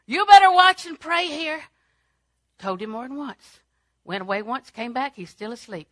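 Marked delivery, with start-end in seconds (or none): 0.1-1.6 loud, high, stern warning; shouted warning. Acted. Jesus warning sleepy Peter in the garden, shouted. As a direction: belt it out as an urgent order. Warning repeated far louder.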